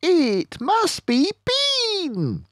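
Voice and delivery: high, quick character voice; lively animal voices in a story; a kid's storybook character, eager; voiced farm animals.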